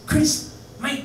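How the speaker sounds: high, loud cockney-ish greeting. Retold or imitated. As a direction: greet him like a chummy British rocker. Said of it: "But he's like this".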